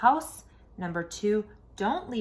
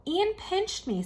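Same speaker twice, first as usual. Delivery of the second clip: put-on high childish complaint; whiny kid tattle; 10 semitones higher than usual. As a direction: tattle in a little boy's voice. Little boy tattling to dad.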